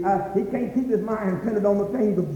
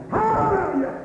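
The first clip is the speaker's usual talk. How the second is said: high crying-out praise; high joyful cry; ecstatic hallelujah; 12 semitones higher than usual. Real, not acted. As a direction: let it burst out. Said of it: "ecstatic cry".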